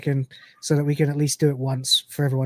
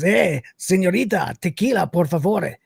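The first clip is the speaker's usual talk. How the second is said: put-on Spanish accent. The cat orders from the woman at the bar, Spanish accent. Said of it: "with, to her with a Spanish accent".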